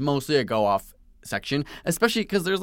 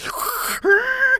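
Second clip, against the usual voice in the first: high, loud vacuum whine; imitated vacuum roar; 5 dB louder than usual. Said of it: "turn it on and be like".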